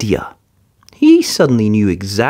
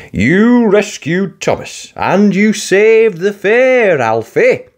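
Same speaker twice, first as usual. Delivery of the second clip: slow, higher, formal voice; Sir Topham Hatt; 9 semitones higher, 3 dB louder, slower than usual. Acted. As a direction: praise him grandly. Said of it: "said Sir Topham Hatt".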